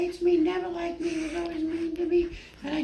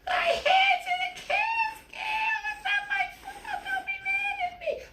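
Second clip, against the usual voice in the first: high-voiced friend. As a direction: plead shrilly for forgiveness. Bernadette pleads, high voice.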